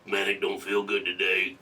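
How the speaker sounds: low, slow sickly croak; call in sick voice. Shown as a demonstration. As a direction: sound too sick to work. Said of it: "I do a great call in sick voice".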